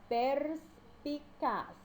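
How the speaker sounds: "perspicaz" very slowly as pers, pi, caz; one syllable at a time. Shown as a demonstration. Teacher showing three syllables, "perspicaz" one by one. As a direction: break "perspicaz" into three high, separated beats. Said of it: "eu falo cada sílaba".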